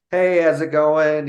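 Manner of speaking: imitating an upbeat store clerk; mock friendly greeting. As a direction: greet a customer brightly, drawn out.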